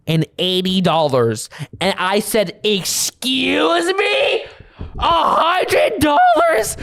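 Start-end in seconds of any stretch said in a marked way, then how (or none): 2.6-6.8 high, slow shocked question; shocked disbelief. Retold or imitated. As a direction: react like the price is outrageous. Retold shocked reply to price.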